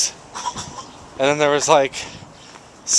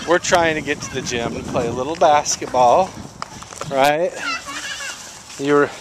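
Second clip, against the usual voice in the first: usual speaking voice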